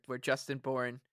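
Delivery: ordinary talk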